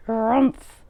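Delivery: bear grunt. Acted. Old Bear grunting at the kitchen smells.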